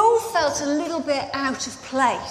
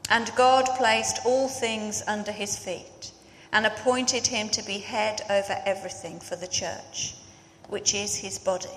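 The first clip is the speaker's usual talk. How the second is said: scripture reading; 2 semitones lower, 5 dB quieter than usual. Acted. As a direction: read the passage with solemn weight. Reads scripture before the sermon.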